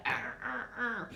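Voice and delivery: mock effortful grunting, like shoving a suitcase; straining grunts. Shoving a suitcase overhead, mock strained grunts. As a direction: push hard with little strained arrs. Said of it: "You go".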